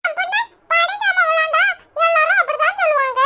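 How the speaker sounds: put-on high, bright little kid asking mum; small child voice. Little child asking mother about her bus trip, high and eager. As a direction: play a tiny excited child.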